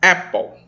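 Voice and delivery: Apple said with open E, like "épol"; correct pronunciation. Shown as a demonstration. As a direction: model Apple clearly, open first vowel. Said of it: "vamos lá". (Apple com e aberto, "épol")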